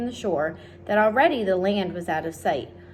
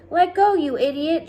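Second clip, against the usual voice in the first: sharp high loud yell; shouted; 9 semitones higher, 6 dB louder than usual. A narrator's reading. Centipede yells at clumsy James, loud angry shout. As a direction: yell at him to let go. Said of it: "shouted the centipede".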